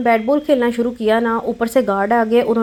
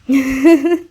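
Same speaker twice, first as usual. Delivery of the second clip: real laugh; 2 semitones higher than usual. Real, not acted. Laughing after the slide ride.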